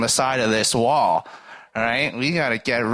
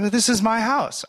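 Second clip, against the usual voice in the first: Well, this is my house pitched much higher, stress on is; firm claim; 10 semitones higher than usual. Retold or imitated. Jesus telling the owner it's his house, pitched high. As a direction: insist on it, voice up high.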